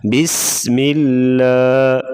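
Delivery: slow "بسم اللہ", light ل and held س; thin laam. Shown as a demonstration. Teacher repeats the correct bismillah, slow, thin laam in "اللہ". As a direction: draw it out, keeping the ل of "اللہ" thin. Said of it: "زیر ہوگی تو اس کو باریک".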